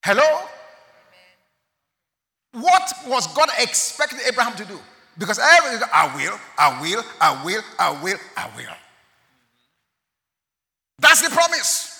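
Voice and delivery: loud, high insistent preaching; preaching at full force. Acted. Preacher pressing the congregation about the promise. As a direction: hammer the repeated I will.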